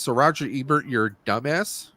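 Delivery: ordinary delivery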